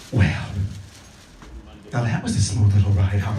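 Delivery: low, creepy come-on to a passenger; creepy driver. Pretend.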